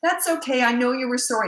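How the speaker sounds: high-pitched imitation of a coddling mom. Mother to her crying son after the rock, mock coddling. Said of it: "She picked him up and coddled him".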